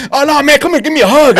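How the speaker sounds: high, loud, eager call for a hug; eager hug demand. Retold or imitated. Victor Rivers pulls host in, loud high excited. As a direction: beg for the hug, bright and loud. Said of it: "Victor Rivers".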